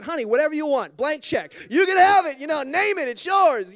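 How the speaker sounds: loud, high, giving it all away; generous outburst. Retold or imitated. Dad to daughter offering anything, loud and overjoyed. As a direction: fling open the checkbook with joy.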